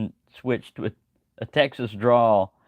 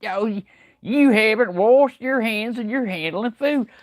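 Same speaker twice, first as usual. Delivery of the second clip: scolding country character; 8 semitones higher, 4 dB louder than usual. Acted. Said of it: "I talk to myself a lot".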